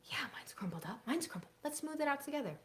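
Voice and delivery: put-on soft whisper to the kids; whispered teacher prompt. Shown as a demonstration. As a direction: hush it, draw them in. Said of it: "hushed classroom demo".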